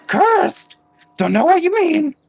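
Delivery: very high, loud preening squeal; vain creature voice. Acted. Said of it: "first one you met is like".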